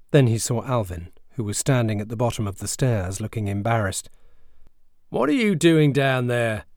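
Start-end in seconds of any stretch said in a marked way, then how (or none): 5.1-6.6 stern demand. Acted. Dad demanding from stairs.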